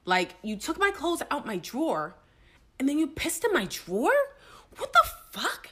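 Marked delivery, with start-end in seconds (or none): 4.8-5.7 high, pitched-up outraged cry; outraged disbelief. Retold or imitated. Teller reliving finding the wet drawer, high outraged cry. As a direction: squeal it in disbelief.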